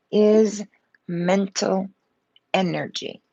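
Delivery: ordinary delivery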